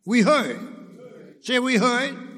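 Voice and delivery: loud, punched pulpit call; calling for response. Real, not acted. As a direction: get the congregation to repeat it. Prompts congregation to repeat.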